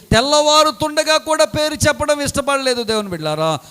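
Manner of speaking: usual speaking voice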